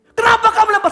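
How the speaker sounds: loud, high accusing question; acted anger. Engineer confronts the worker who threw iron, angry. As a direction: demand an answer in a rage. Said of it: "lihat di marah-marahnya".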